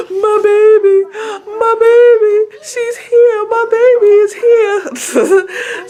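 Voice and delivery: loud, high wailing imitation of happy sobs; hammed-up bawling, gasping and drawn out; mock boo-hooing. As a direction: imitate crying your eyes out over your newborn. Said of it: "I boo-hooed like a little baby".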